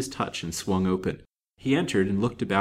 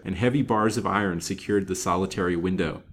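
ordinary delivery

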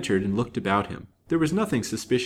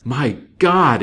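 loud, higher-pitched horrified cry; horrified exclamation; 5 semitones higher, 10 dB louder than usual. A narrator's reading. Detective finds the chained woman, loud horrified exclamation. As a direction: burst out in shock. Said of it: "exclaimed Mister Shelton".

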